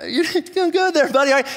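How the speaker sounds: high, laughing encouragement; Jesus cheering Peter on. Acted. Jesus encourages Peter on the water, high and laughing. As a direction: cheer him on through a laugh. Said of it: "and Jesus is like".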